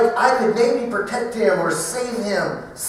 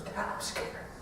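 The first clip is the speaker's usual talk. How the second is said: scared whisper; 17 dB quieter than usual. Retold or imitated.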